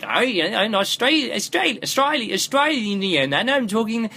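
high-pitched, hammed-up bad Australian accent. Reviewer mocking the audiobook narrator, awful Australian accent. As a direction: mangle an Australian accent, stumbling over the vowels. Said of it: "suddenly there's these Australians start talking".

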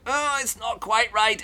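high-pitched mock discomfort; mildly exasperated high whine; on edge. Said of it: "a little bit on edge".

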